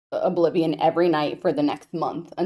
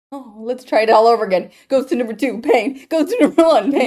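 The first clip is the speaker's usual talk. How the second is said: higher, louder, racing through the steps; worked-up blow-by-blow, getting louder; frantic retry; 5 semitones higher, 8 dB louder than usual. Acted. Acting out her retrying the levers, frantic.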